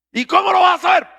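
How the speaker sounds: high, loud rhetorical challenge; preaching shout. Real, not acted. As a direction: fire the question at them. (pregunta gritada de predicador)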